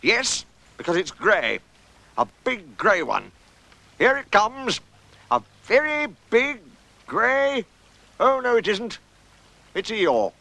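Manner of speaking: slow, building anticipation, rising in pitch; mild suspense, then a falling realisation; commentator on the sighting. Acted.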